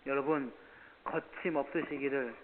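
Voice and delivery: normal talking voice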